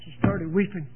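ordinary delivery

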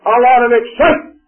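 chiding Elijah. Acted. Preacher scolding Elijah for wanting spectacle, mocking and loud. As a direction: tease him for wanting a big show.

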